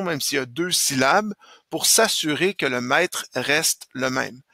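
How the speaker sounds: ordinary talk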